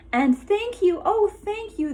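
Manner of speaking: high, loud thank you; grateful cry. Acted. Grateful witch thanks the beast, high and loud. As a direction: gush with gratitude. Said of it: "the grateful witch cried".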